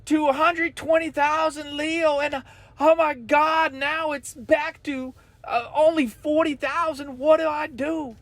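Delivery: high, panicky mock trader voice; panicked trader. Follower panicking to Leo about his account, high and frantic. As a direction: play a frantic follower losing money. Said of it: "people that are just screaming".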